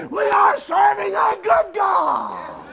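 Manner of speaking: shouted and drawn out slowly; preacher's full-voice declaration; preached loud. Shown as a demonstration. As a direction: ring it out as a proclamation. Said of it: "repeated softly".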